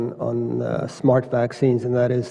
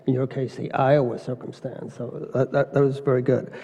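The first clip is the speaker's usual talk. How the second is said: ordinary delivery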